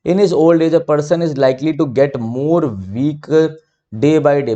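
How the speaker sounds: very slow, word-by-word read of an example sentence; slow dictation reading. Shown as a demonstration. Teacher reading an exercise sentence to students, slow and deliberate.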